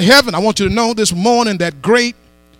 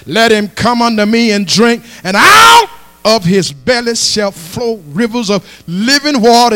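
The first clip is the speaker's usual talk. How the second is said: normal talking voice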